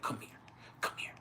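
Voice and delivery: breathy urgent whisper, barely voiced. Girlfriend hiding from her mom at the store, whispering urgently. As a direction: call him over without being heard.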